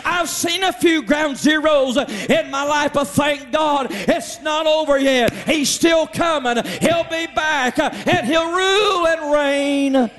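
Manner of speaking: lightly voiced shouting praise, high, slowing at the end; happy churchgoer. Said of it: "get happy at Tabernacle and say".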